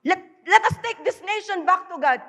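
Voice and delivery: loud, high slogan shouted to the crowd; rally cry. Retold or imitated. The church's mission slogan shouted to the congregation, loud. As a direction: proclaim it like a rally chant. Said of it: "na sinasabi natin".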